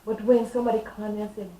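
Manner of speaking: usual speaking voice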